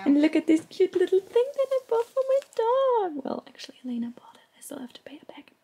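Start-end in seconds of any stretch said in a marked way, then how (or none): 3.3-4.4 hushed confessional aside; whisper. Real, not acted. 4.6-5.5 hushed confessional aside; whisper. Real, not acted.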